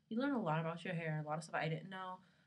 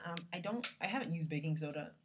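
ordinary talk

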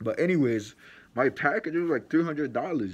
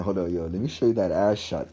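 usual speaking voice

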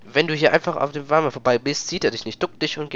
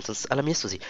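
ordinary delivery

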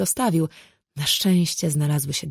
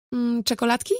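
higher-pitched coaxing offer; tentative offer; 7 semitones higher than usual. A narrator's reading. Offering chocolates to a toddler, tentative and coaxing. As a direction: offer a treat in a lifted, questioning voice. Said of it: "zaproponowałam z braku innego pomysłu".

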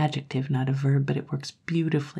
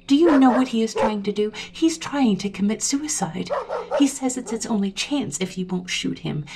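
urgent scorn building to high, loud demands; goading; 6 semitones higher, 4 dB louder than usual. A narrator's reading. Sally taunts the owner into shooting his dog, goading. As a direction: goad him harder and harder, ending in a shout.

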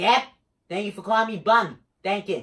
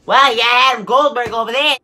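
much louder, higher shout; loud call-out; 4 semitones higher, 11 dB louder, faster than usual. Pretend. Gamer spotting someone over there, loud call-out. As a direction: holler it across the room. Calling out to game character.

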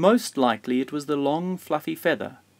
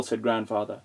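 plain reading voice

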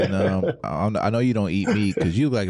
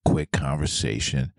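usual speaking voice

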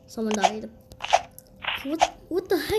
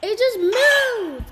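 high, drawn-out startled cry; real surprise, pitched high and stretched; startled outcry; 7 semitones higher, 5 dB louder, much slower than usual. Real, not acted. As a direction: cry out in alarm at the game, slower and louder. Startled by moving game obstacle.

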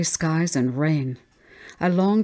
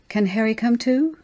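put-on high little-girl plea, rising at the end; high, wheedling child question; child pleading; 7 semitones higher than usual. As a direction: ask like a hopeful small child. Child pleads again with mother.

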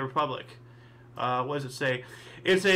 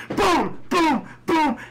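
much higher, louder shouted boom noises; mock gunshots; 10 semitones higher, 5 dB louder, much slower than usual. Recreating the Joker movie shooting, loud mock gunshot booms. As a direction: blast out the shots like a movie scene.

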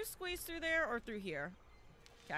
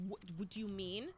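ordinary talk, nothing marked

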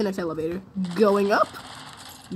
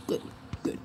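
ordinary talk

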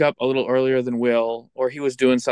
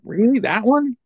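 high, incredulous double-take; surprised; 11 semitones higher than usual. Retold or imitated. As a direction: ask it in disbelief, pitch way up. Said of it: "And I was like".

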